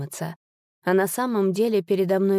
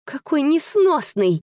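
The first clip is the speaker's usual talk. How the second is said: higher, drawn-out exasperated cry; exclaiming; 8 semitones higher, 4 dB louder, slower than usual. A narrator's reading. Sister reluctantly amused by the devil, exasperated exclamation. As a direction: exclaim slowly, half amused. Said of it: "воскликнула Кларича".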